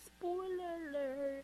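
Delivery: high, slow, soft drawl; teasing warning. Pretend. Warning listeners about a death, slow high tease. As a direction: stretch it out high and quiet.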